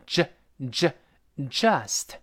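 "just" from a slow isolated "juh, juh", not "jee"; correct j sound. Shown as a demonstration. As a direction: say "juh" twice, then "just". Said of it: "前面不是读鸡".

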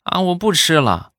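put-on high, small child voice; child's voice. Xiaoming refusing his mother, high child voice. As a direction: refuse like a little kid.

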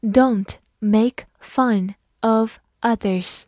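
slow, careful word-by-word reading; clear, deliberate teacher's model reading. Shown as a demonstration. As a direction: read it slowly for learners. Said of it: "好，慢读一遍".

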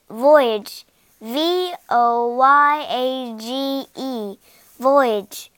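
slow letter-by-letter spelling. Shown as a demonstration. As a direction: spell each letter slowly for the class. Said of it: "第一个单词voyage".

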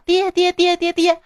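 high, repeated teasing calls of dad; calling out. Retold or imitated. Kid chasing a village boy down the street, calling out. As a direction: chase him and keep yelling dad.

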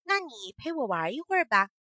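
happy. Acted. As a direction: ask the ant to play, delighted. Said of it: "萤火虫高兴地说".

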